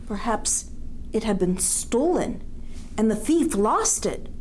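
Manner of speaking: animated narrator with swooping, lilting pitch; storybook reading to children. A narrator's reading. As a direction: build the worry as you read to children. Reading a picture book aloud.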